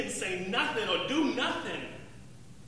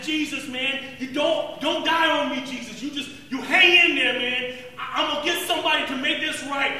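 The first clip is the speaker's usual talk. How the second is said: loud, high, urgent begging; desperate pleading shout; 5 semitones higher, 8 dB louder than usual. Retold or imitated. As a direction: beg him not to die. Pleading with dying Jesus.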